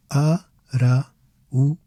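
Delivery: "Araújo" slowly as a, ra, u, jo; broken into syllables. Shown as a demonstration. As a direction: pause after every syllable of "Araújo". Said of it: "It breaks down as".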